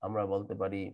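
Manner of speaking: ordinary delivery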